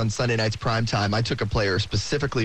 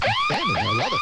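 excited squeal; faster than usual. Real, not acted. High excited exclamation.

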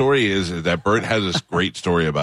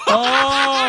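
a loud, high burst of laughter; laughing; 4 dB louder than usual. Real, not acted. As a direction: howl with laughter on oh. Laughs at the story.

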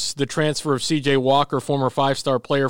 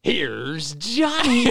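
slow, drawn-out higher showman announce; hammy stage actor; 5 semitones higher, much slower than usual. Retold or imitated. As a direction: stretch it out like a late-night announcer. Cohost echoes intro as showman.

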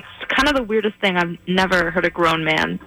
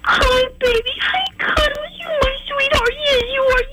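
put-on very high cooing baby voice; baby talk to a dog. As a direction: coo at the puppy like an infant. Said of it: "can you just do an impression of him again".

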